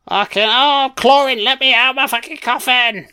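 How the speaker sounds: high-pitched trapped-captive wail; mock desperate cry. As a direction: beg to be let out, hammed-up and high. Voices vampire trapped in coffin.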